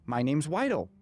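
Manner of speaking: "Weidel" with a w and an l in place of each r; toddler pronunciation. Retold or imitated. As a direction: imitate a toddler saying "Weidel" for Ryder. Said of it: "the first things that he started saying".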